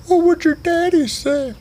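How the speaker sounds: a high teasing squeak; Sugar Tits voice. Acted. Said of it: "He's like".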